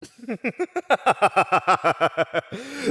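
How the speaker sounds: long, high villain laugh; evil cackle. Acted. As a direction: chuckle that bursts into loud cackling. Villain amused by captive's objection.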